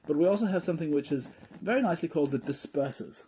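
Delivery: normal talking voice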